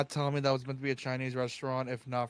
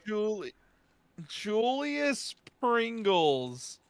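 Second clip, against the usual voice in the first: very slow, high drawn out; stunned; 8 semitones higher, 3 dB louder, much slower than usual. Real, not acted. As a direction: savour the name in disbelief. Incredulous repeating the name.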